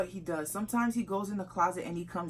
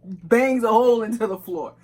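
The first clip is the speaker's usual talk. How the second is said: loud, high and laughing; laughing while talking; 7 semitones higher, 14 dB louder than usual. Real, not acted. Girlfriend teasing him about how he dresses, laughing loudly. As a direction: push the words out loudly through laughter.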